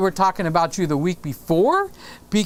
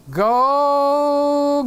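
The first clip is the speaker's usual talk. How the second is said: silly voice; 6 semitones higher, 3 dB louder than usual. Pretend. Teasing Peter with a silly voice.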